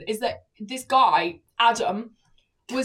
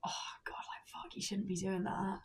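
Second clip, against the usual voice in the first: breathy flirty whisper, almost unvoiced; flirtatious; 4 semitones lower, 18 dB quieter than usual. A guy pushing her away flirtatiously in a whisper. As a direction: tease the no so it means yes. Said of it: "but very flirtatious".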